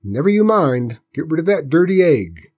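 loud, high, no-nonsense order; bossy aunt laying down the law; stern scolding. Acted. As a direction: cut off the protest sharply. Aunt cuts off boy, ordering.

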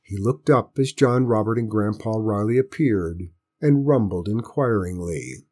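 plain reading voice

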